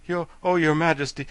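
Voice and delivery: gasping. Acted. Soldier reports to the king, breathless stammer. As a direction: stumble in out of breath. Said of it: "gasped the royal army, who was all out of breath".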